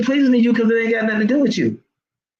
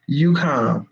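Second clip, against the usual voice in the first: calm; 6 semitones lower than usual. Shown as a demonstration. Contrasting chaos with calm response.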